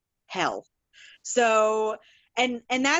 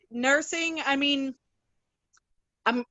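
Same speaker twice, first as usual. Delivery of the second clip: usual speaking voice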